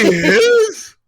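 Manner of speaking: high laughing squeal of disbelief; shrieking with laughter; laughing shock. Real, not acted. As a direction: gasp it out through laughter.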